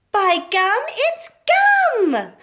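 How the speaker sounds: very high, loud excited shriek. A narrator's reading. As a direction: squeal it as the gum-mad girl spotting gum. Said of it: "she shrieked".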